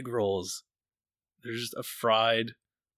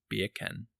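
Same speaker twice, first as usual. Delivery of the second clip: Australian accent; 7 dB quieter than usual. Shown as a demonstration. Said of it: "beer can in an Australian".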